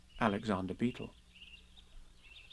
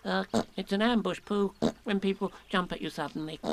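Piglet's voice; 7 semitones higher than usual. Acted. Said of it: "explained Piglet".

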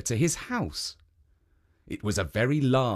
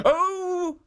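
high innocent guardian; high statue playing innocent. A narrator's reading. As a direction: feign innocence, pitched up. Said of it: "said Jitso".

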